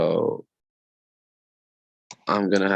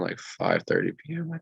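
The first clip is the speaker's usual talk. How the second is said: voice cracking; 11 semitones higher, 4 dB quieter than usual. Real, not acted. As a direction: voice cracks up high mid-sentence. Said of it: "Sorry, my voice is cracking".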